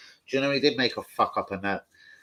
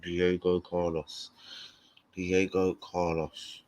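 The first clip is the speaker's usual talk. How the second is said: "Diego Carlos" slow, low and soft; slow pondering; 5 semitones lower, 5 dB quieter, much slower than usual. Real, not acted. Fan savouring a centre back's name, slow pondering. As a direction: say "Diego Carlos" at half pace, dropping low. Repeating a player's name slowly.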